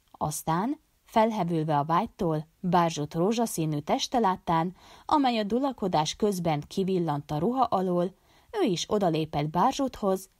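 ordinary delivery

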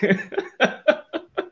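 bright rising laugh at himself; real laugh. Real, not acted. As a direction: laugh it off, amused at your own excess. Laughs at his own overdoing.

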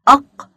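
"أق" punched loud, stopping on the qaf; "أق" cut off on a popped q; sounded-out qaf. Shown as a demonstration. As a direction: sound out "أق" as one short, loud syllable. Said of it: "سمعنا صوت حرف القاف".

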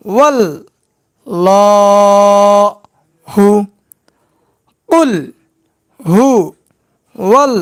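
each syllable said apart with pauses; slow syllable-by-syllable drill; broken syllables. Shown as a demonstration. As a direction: break কুল হু wall ল হু into separate beats. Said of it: "এবার ভাঙা ভাঙা উচ্চারণ".

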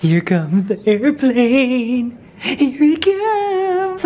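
put-on slow, high sing-song for a baby; fly the spoon in playfully, slowly; airplane spoon game.

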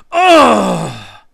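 very loud, long, high furious roar; roar of rage. A narrator's reading. Ishtar flinging herself about, roaring in rage. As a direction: let out an ecstasy of rage. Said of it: "in an ecstasy of rage".

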